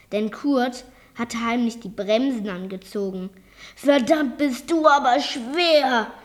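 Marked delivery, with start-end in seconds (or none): 3.8-6.1 a touch of straining, higher and louder; gasping with effort. A narrator's reading. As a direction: push the words out under a heavy load. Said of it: "keuchte der Mann". (leicht angestrengt gekeucht)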